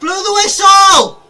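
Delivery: high, slow, loud pleading shout; pleading with the referee. Real, not acted. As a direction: drag out the plea to the ref. Pleading again, drawn out.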